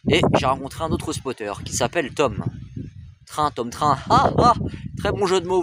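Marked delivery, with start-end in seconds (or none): 4.1-5.6 mock viewer praise. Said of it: "vous allez me dire".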